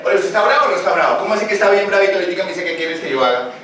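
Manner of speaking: rápido, incrédulo, medio riendo; regaño burlón y veloz; reproche extrañado. Retold or imitated. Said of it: "cualquiera diría". (un testigo se burla del bravo ablandado)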